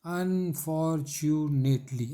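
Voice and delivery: "Unfortunately" slowly separated into five beats; measured teacher's breakdown of "unfortunately"; syllable by syllable. Shown as a demonstration. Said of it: "उसी शब्द को हम लोग लिए".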